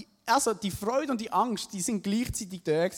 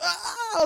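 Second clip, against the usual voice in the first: high, laughing startled shriek; startled scream; 12 semitones higher, 4 dB louder than usual. Retold or imitated. Surfer startled by a big fish, high laughing shriek. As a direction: jump out of your skin with a yelp. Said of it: "ich bin so verschrocken".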